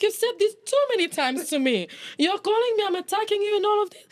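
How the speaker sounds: high, fed-up retelling of her complaint; exasperated complaint. Retold or imitated. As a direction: pile the grievances on, pitched well up. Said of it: "I'm like".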